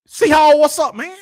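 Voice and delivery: high, loud call-out that eases off; hollered greeting. Real, not acted. Host greeting a returning viewer, high hollered hello. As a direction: hail a friend who has been missing.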